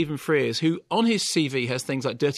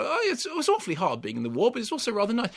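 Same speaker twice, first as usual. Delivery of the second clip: mock posh wartime voice; 7 semitones higher, faster than usual. Parodying the film's quaint tone.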